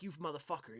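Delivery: low muttered curse at friend. Retold or imitated. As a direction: mutter it under your breath. Muttered to Brad.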